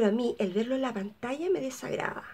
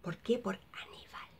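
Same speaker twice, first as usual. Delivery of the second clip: whispered, much softer, like a secret; hushed reveal; 4 semitones lower, 11 dB quieter than usual. Pretend. Vlogger hinting at the cannibal role, eerie whisper. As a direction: breathe the name Aníbal like a creepy secret.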